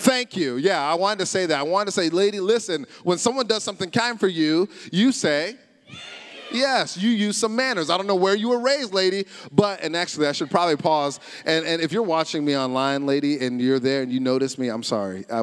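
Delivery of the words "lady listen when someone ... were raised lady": retold scolding, pitched higher; mildly mad. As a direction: tell her off with mock indignation. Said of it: "I was mad at you".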